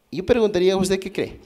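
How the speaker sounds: usual speaking voice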